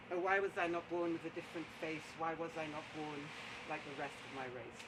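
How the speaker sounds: very soft, low recitation; hushed lament. Acted. Storyteller redoing the poet's lament after a slip, hushed and low. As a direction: let it sink down almost to nothing.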